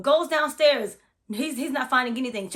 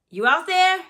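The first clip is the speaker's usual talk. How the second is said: louder call; 6 semitones higher, 5 dB louder than usual. Retold or imitated. Calling again when nobody answers, louder.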